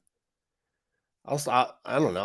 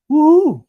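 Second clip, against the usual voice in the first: much higher, loud self-cheer; mock cheer; 13 dB louder than usual. Teacher cheering his own degree, loud mock whoop. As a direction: whoop at yourself in jest. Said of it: "Wow, I'm really smart".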